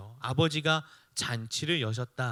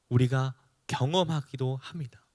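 usual speaking voice

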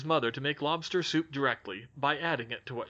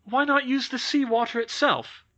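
put-on eager boy's question; 11 semitones higher, 7 dB louder than usual. Boy Jack asks eagerly.

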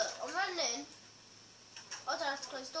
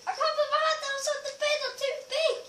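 loud, high complaint; high-pitched whine; 11 semitones higher, 4 dB louder than usual. Real, not acted. Player too big for the bed, high whine.

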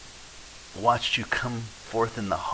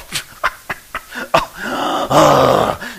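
dramatic gasp; 4 semitones higher, 9 dB louder than usual. Pretend. Mock horror at being asked.

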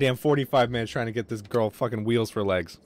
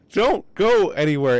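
slow, louder, higher plea; calling out; 7 semitones higher, 6 dB louder, slower than usual. Real, not acted. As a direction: call after her to stay put. Calling to game character.

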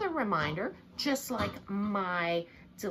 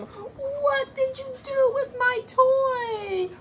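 very slow, high, crying whine; slow whiny demand; 7 dB louder, much slower than usual. Acted. Olivia asking baby William, slow high whine. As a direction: stretch each word like a tearful kid.

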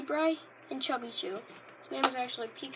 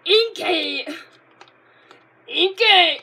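loud, higher call of the name; Pokemon cry; 4 semitones higher, 16 dB louder, much slower than usual. Retold or imitated. Kid imitating the anime Pokemon cry, loud and higher.

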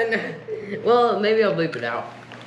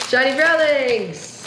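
excited announcement; 5 semitones higher, slower than usual. Real, not acted. Announcing the shiny pull.

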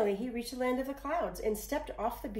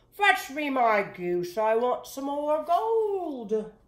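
much louder, slow giant's command; giant demanding; 7 semitones higher, 11 dB louder, slower than usual. A narrator's reading. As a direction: bark orders like a greedy giant. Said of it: "and then demanded".

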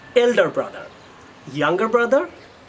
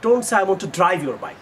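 ordinary delivery

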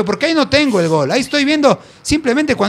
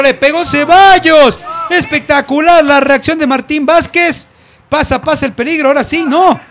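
rising thrill as the shot flies; excited play-by-play; 7 semitones higher, 6 dB louder than usual. Real, not acted. Shot on goal, live call.

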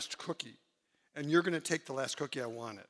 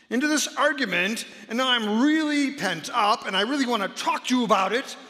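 higher and louder, worked up and pushing; a mock worked-up quarreller, higher and louder; pent-up arguing; 6 semitones higher, 6 dB louder than usual. Shown as a demonstration. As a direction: let the bottled-up feelings burst out. Acting out pent-up fighting.

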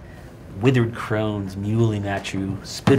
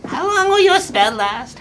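high shrieking monster taunt; 7 dB louder than usual. Acted. Monster taunts, much higher.

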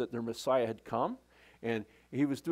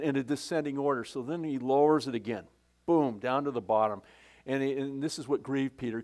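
ordinary talk

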